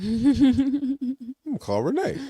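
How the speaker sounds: high, amused rising hmm; delighted discovery of her number; intrigued realization. Retold or imitated. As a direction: realize it's her name with a laugh. Recognizing her name in magazine.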